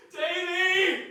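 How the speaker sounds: anguished cry. Acted. Calling out in grief.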